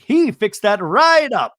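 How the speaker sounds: high, loud, drawn-out punchline; retold jokey exclamation. [name] joking about him fixing everything, loud and drawn out. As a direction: sell the quip slowly and loudly.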